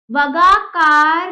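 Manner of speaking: वगाकार slow and loud, "va-gaa-kaarr", r dragged to the end; wrong pronunciation. Shown as a demonstration. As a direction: stretch out the misreading वगाकार, no r before the g. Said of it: "नहीं".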